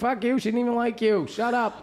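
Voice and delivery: high-pitched mock angry comeback; quarrelling retort. Brother snapping back at brother, quarrelling.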